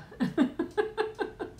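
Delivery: high airy giggle rising at the end; breathy giggle. Real, not acted. Giggling after reading the chat aloud, airy and high. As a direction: let out a breathless little giggle. Laughs after squeaky quote.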